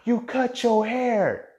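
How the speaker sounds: high, drawn-out gasping disbelief; mock shock. Schoolmates reacting to his haircut, shocked. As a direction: stretch it out high, scandalized.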